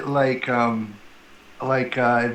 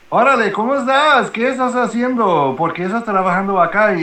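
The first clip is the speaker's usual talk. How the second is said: fast, higher showy Spanish; testing a customer with fast Spanish; rapid Mexican Spanish; 6 semitones higher than usual. Shown as a demonstration. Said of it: "And I'll be talking like that".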